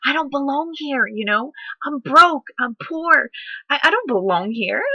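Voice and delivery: voicing her old panicked doubts; inner doubting voice. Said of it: "I'm like".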